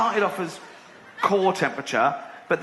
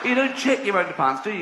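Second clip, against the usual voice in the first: indignant outburst; 6 semitones higher than usual. Real, not acted. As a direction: throw up your hands in outrage.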